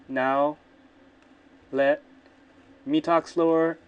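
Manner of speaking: loud, word by word with long pauses; slow, loud, drawn-out test speech. Shown as a demonstration.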